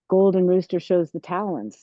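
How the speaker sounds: normal talking voice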